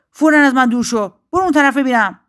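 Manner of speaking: high, loud, sharp order; haughty dismissal. A narrator's reading. Proud willow sends the bird away, sharply dismissive. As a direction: shoo the bird off loudly. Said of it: "با غرور".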